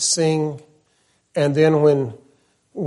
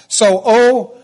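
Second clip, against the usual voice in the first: lament; 5 semitones higher, slower than usual. Retold or imitated. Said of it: "He says it twice".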